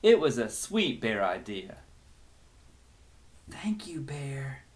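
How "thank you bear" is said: soft, breathy whisper. Acted. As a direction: whisper your thanks to the bear. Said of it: "the girl whispered".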